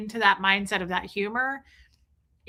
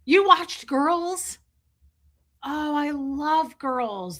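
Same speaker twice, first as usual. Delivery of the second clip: delighted surprise; 6 semitones higher, much slower than usual. Real, not acted. Surprised reply to a chat comment.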